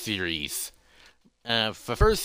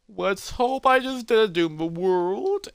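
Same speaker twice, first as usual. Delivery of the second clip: high, slow, drawn-out worry; hope anxiously, pitched way up; high-voiced acted apprehension; nervous hoping; 9 semitones higher, slower than usual. Hero frets after risky act.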